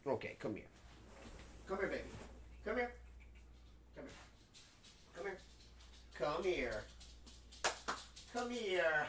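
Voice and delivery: quiet, gentle calling to a pet; hushed pet call; soft coaxing. Real, not acted. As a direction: coax the animal over softly.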